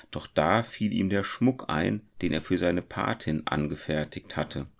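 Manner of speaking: ordinary delivery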